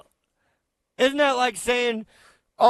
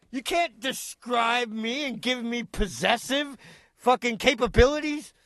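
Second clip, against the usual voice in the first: ordinary talk, nothing marked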